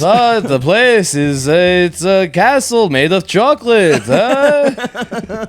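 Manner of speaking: put-on high vampire count accent; Transylvanian accent. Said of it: "it is tough to not go Transylvanian".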